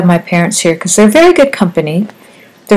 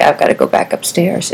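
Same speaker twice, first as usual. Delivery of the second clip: weak whisper; 6 dB quieter than usual. Retold or imitated. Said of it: "he's like".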